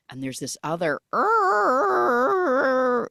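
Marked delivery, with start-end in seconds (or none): 1.1-3.1 high startled "er"; a jumpy little noise of fright; startled grunt. Retold or imitated. Girl in the hut, startled by a noise: high grunt.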